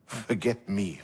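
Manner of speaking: normal talking voice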